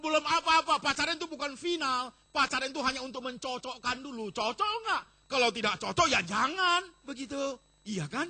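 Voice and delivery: loud, high scolding sermon; preaching at full force. Acted. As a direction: rebuke the foolish vow from the pulpit.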